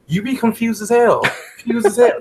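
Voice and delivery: high, emphatic exasperation; exasperated. Real, not acted. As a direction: hammer home the confusion. Emphatic repeat, high pitch.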